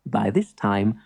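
plain reading voice

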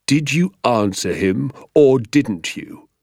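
slow, deliberate demand; menacing, drawn-out teacher's question; stern interrogation. Acted. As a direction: press each word on the culprit. Teacher presses the pupil for answer.

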